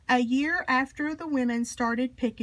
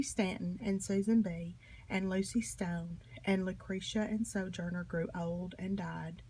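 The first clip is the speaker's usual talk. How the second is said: hushed whisper; 4 semitones lower, 7 dB quieter than usual. A narrator's reading. Narrator whispering to children that the old leaders died. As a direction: drop to a soft, breathy whisper.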